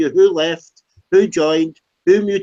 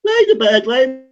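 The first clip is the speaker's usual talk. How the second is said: high-pitched parroting of a complaint; mocking imitation; 6 semitones higher, 4 dB louder, faster than usual. Parroting a rival's complaint about bad language, mocking. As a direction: throw it back in a high mocking voice.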